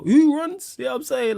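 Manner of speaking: high-pitched, disbelieving challenge; incredulous scoff. Real, not acted. Streamer told he is too scared to call in, incredulous.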